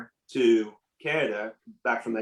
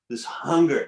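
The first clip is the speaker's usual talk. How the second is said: normal talking voice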